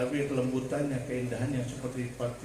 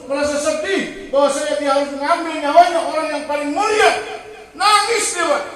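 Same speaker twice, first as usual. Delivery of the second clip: loud, high sermon rising to a shouted peak; building full-force preaching; 11 semitones higher, 11 dB louder than usual. Real, not acted. Preacher tells how the angel of death wept, building full-force preaching.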